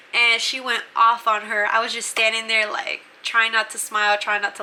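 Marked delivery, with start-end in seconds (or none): none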